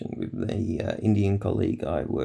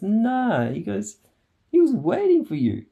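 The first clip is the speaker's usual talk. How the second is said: mock boss; 13 semitones higher than usual. Boss insisting, voiced high.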